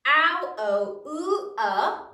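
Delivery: "ow, o, oo, uh" as separate sounds, loud; loud, evenly spaced "ow, o, oo, uh" for the class; phonogram recital. Shown as a demonstration. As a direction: chant out "ow, o, oo, uh" firmly, one sound at a time. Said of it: "Say the first one with me".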